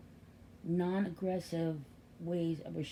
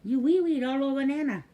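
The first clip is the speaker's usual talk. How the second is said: put-on higher and louder teasing scold of the baby; playful mock scolding; 6 semitones higher, 8 dB louder, faster than usual. Nana to baby who wee-weed on her, playful mock scold. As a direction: wag a finger at him in fun. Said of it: "And I said".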